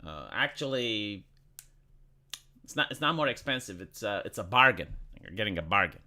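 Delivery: low, soft mock salesman voice; deep smug sales pitch, quiet; low mocking shop voice; mocking retailer. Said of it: "they will say it's like".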